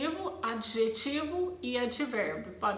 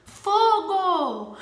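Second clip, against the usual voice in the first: higher, much louder cry of fire; alarmed shout; 7 semitones higher, 9 dB louder than usual. Shown as a demonstration. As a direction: shout to warn everyone. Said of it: "Agora, se eu falo".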